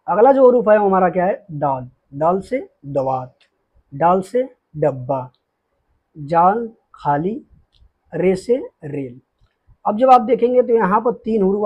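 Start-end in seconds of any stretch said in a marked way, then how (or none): 1.5-9.2 slow recitation. Shown as a demonstration. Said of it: "teacher reciting new letters slowly".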